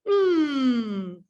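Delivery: a long humming mm; M sound. Shown as a demonstration. Teacher leading learners in the M sound, humming. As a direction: hold the hum through the nose. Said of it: "Touch your nose".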